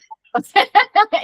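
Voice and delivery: high, loud burst of laughter. Real, not acted. Speaker amused by her own loud energy, high laughing burst.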